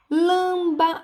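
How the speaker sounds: LAMBA drawn out, held high; drawn-out syllables. Shown as a demonstration. Teacher sounding out a word for pupils, drawn-out syllables. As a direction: stretch out LAMBA slowly on a high pitch. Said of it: "a gente demora mais".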